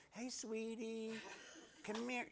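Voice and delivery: high, slow sing-song coax; sweet coaxing. Acted. Parent calling a toddler back from the street, high and coaxing. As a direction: lure the little one back gently.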